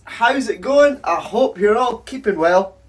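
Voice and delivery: high, loud, bouncy greeting to viewers; upbeat vlog intro. Acted. Host greeting viewers, high loud and upbeat. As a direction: pump up the audience at the start.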